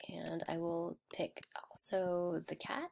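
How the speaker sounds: soft whisper while working. Real, not acted. Vlogger drawing her avatar, whispering to herself. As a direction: whisper it to yourself.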